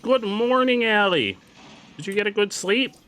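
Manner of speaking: high, loud welcoming voice; cheery morning greeting; greeter voice. Acted. Greeting a customer in game.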